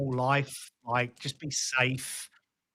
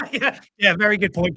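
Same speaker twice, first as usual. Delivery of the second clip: laughing; 6 semitones higher, 7 dB louder than usual. Real, not acted. Laughing reply to a chat comment.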